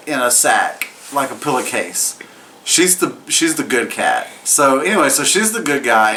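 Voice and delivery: ordinary talk, nothing marked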